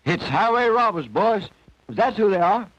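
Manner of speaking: high rooster voice, sure of himself; knowing old rooster. A narrator's reading. Rooster telling the boys who lives here, high and certain. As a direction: pitch it up like a cocky old bird.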